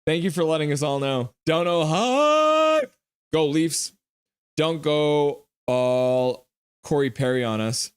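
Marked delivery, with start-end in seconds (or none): 1.4-6.4 hyped. Real, not acted. Reading chat to viewers, stretched out and hyped. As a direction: draw it out like a hype chant.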